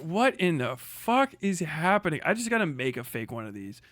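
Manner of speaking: usual speaking voice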